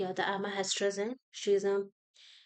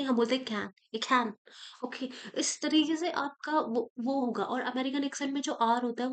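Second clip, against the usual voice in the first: ordinary talk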